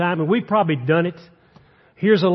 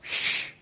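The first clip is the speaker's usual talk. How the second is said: long soft "shh"; hushing shush; 12 dB quieter than usual. Retold or imitated. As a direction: shush someone as a disapproving crowd. Crowd hushing the blind man.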